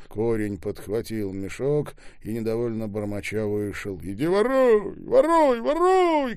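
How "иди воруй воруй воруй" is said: climbing, very high yells; shouting. A narrator's reading. Скоба repeating his catchphrase at everyone, shouting. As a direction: scream each repeat higher. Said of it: "кричал Скова".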